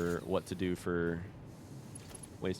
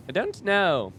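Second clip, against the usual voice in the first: loud high exclaim; 10 semitones higher, 6 dB louder, slower than usual. Real, not acted. Repeated louder, higher.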